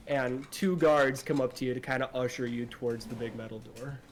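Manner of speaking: ordinary delivery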